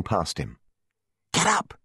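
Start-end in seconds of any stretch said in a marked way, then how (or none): 1.3-1.7 high, urgent, lightly panted; panted urging. A narrator's reading. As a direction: urge someone up, short of breath. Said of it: "she panted".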